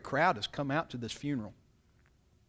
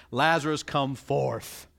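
King James command; 4 dB louder, slower than usual. Acted. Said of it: "I prefer the King James".

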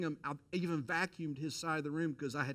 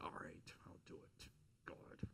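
whispered, reluctant giving in; a grudging whispered surrender; 7 semitones lower, 18 dB quieter than usual. Retold or imitated. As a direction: breathe it out like a defeated yes.

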